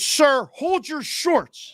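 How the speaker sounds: high, slow, clipped-by-the-book officer order; stern TSA officer. Retold or imitated. Airport agent ordering a traveller, mock stern and slow. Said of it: "She goes".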